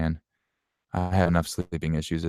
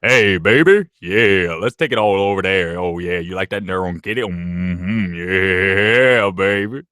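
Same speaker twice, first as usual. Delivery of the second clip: seductive voice; 6 dB louder, slower than usual. Pretend. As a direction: croon it like a cheesy lover. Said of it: "one of my favorite voices".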